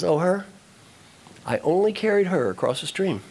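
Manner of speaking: ordinary delivery, nothing marked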